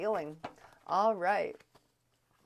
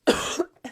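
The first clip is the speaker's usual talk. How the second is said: sharp loud cough; real cough, throat strained; 9 semitones higher, 10 dB louder than usual. Real, not acted. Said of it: "I'm so sorry about coughing".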